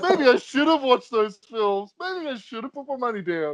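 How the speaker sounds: hammed-up second thoughts about the money; mock regret. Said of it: "now going".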